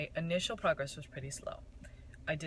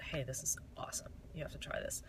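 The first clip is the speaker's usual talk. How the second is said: hushed, breathless recommendation; whispered rave; 3 semitones lower, 7 dB quieter than usual. Retold or imitated. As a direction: whisper it like a secret find. Friend raving about the mascara.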